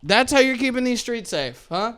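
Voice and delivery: high-pitched mocking question; sarcastic taunt. Driver to cop who gave the ticket, sarcastic taunt. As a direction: needle the officer with mock praise.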